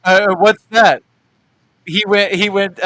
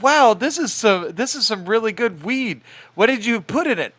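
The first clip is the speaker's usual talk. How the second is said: higher, mock amazement; a touch of wonder, pitched up; lightly voiced impressed visitor; 7 semitones higher, 3 dB louder than usual. Visitor asking what's in the weed, lightly voiced amazement.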